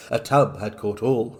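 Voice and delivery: usual speaking voice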